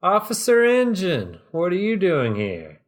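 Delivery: high, drawn-out cry of recognition; startled greeting, starting high and slow; surprised exclamation. A narrator's reading. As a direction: exclaim at spotting someone you know. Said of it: "Rusty exclaimed when he saw their waiter".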